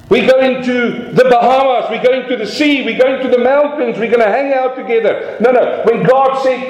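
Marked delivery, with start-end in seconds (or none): 0.1-5.4 high-pitched eager list of plans; mock holiday excitement. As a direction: play a naively thrilled holidaymaker.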